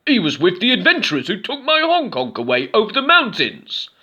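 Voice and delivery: high, loud, odd-sounding troll; a complaining troll with a strange timbre. A narrator's reading. Troll telling Goblin about Honk Honk, odd loud voice. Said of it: "said the troll".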